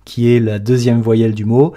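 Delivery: ordinary talk, nothing marked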